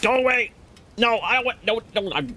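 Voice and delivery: high, agitated protest; hammed-up flustered refusal, pitch way up; frantic shooing. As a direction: drive an intruder away in a high voice. Shooing an unexpected caller away.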